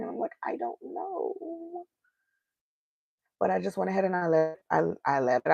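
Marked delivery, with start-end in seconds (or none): 0.4-1.8 very high, soft, drawn-out mock crying; imitated tearful wail. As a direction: squeak it out slowly, imitating yourself in tears. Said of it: "then I started to cry".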